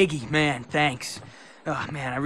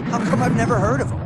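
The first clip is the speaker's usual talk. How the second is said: louder, higher and fast; loud surprise; 8 semitones higher, 8 dB louder, faster than usual. Acted. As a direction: blurt it out in amazement. Stunned he never heard of legend.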